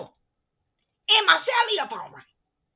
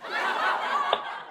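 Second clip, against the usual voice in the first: airy high-pitched giggle; breathy amused giggle; 9 semitones higher, 4 dB quieter than usual. Real, not acted. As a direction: giggle at the gossip. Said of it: "I'm just laughing".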